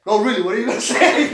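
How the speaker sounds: quick, laughing insistence; nervous laughing plea. Retold or imitated. To his uncle, begging to know, laughing nervously. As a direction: push for the answer through a laugh.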